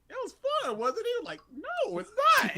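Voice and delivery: very high-pitched mock squabble; exaggerated high-voiced back-and-forth; retold bickering.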